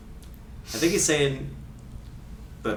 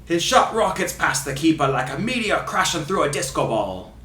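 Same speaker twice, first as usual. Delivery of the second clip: put-on Ray Hudson Scottish accent. Host reading a free kick quote, in fake Scottish accent. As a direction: fake Scottish commentator, theatrical. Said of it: "doing his accent".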